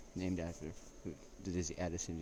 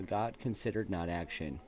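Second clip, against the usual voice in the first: reading a quote aloud; 5 dB louder than usual. Acted. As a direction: read it out like a solemn citation.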